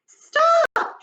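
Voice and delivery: high, loud shrieking stop; shouted stop. A narrator's reading. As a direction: scream it to halt them. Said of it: "she shouted".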